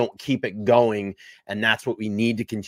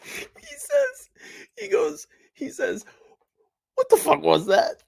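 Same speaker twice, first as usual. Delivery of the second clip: squeaked out much higher through crying laughter; helpless laughter. Real, not acted. Cohost retelling the host's reaction, helpless laughter. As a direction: try to retell it but keep breaking up.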